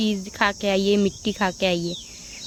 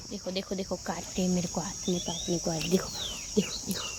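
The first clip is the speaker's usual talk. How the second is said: hushed; 3 semitones lower, 12 dB quieter than usual. Real, not acted. Hushed filming of dog.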